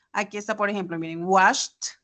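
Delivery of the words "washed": washed said as "washt", one syllable; T ending. Shown as a demonstration. Teacher gives example washed, -ed as T. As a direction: end washed on a released T. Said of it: "se va a pronunciar como T".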